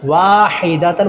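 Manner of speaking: वाहिदतन slow with waa held long; lengthened waw. Shown as a demonstration. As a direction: draw out the first vowel of वाहिदतन. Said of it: "वाव को लंबा करेंगे". (वाहिदतन का 'वा' लंबा)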